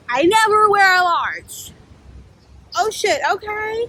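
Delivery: high, drawn-out replayed disbelief; shocked realisation. Speaker discovers she is wearing a large, high mock-shocked voice.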